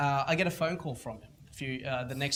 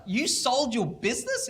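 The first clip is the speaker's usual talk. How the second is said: higher, slower stunned question; shocked; 8 semitones higher, 4 dB louder, slower than usual. Retold or imitated. Mentor hears the kid sold his business, stunned. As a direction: let the shock stretch each word.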